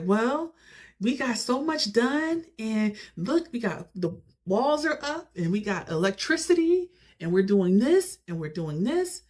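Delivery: high-pitched mock cheery update; jovial and happy. D showing off the house to relatives, jovial and happy. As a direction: mimic her upbeat boasting, pitched well up. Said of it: "she's all jovial and happy".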